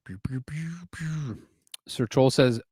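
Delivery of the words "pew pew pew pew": soft, voiceless "pew" sounds; idle little laser-gun shots under the breath; whispered laser noises. Pretend. Streamer idling while building, whispered laser noises.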